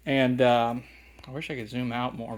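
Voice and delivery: ordinary talk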